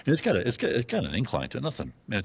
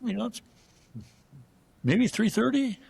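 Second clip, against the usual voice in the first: much higher, drawn-out reluctant answer; hesitant guess. Retold or imitated. Narrator reluctantly rating the host's bull, high hesitant. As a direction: stall before breaking bad news. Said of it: "I'm like".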